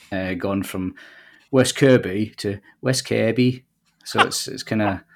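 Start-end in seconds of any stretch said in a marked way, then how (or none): 1.5-2.3 West Kirby in a rough Kent accent; attempted Kent accent. Shown as a demonstration. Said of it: "That was a Kent accent, was it?". 2.8-3.6 West Kirby with a Wirral-accent vowel, like "Kairby"; imitated Wirral accent. Shown as a demonstration. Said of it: "now been living on the Wirral".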